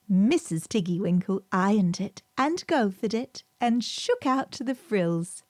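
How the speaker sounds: plain reading voice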